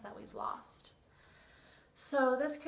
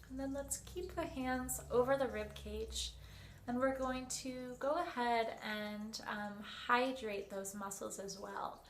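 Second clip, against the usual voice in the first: usual speaking voice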